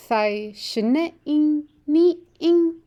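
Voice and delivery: "Thai shne i ni ing" said slowly, each syllable spaced, pitch lifting on the tones; slow model sentence. Shown as a demonstration. Teacher models a Shoshone sentence for the class, slow and careful. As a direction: model it slowly for learners to copy. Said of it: "so repeat the sentence after me now".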